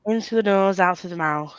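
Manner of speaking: normal talking voice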